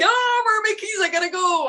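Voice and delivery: high, whimpering mock panic; panicked whine. Acting out someone late and searching for keys, high panicky whine. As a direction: whine like you're about to cry over lost keys. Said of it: "You're like".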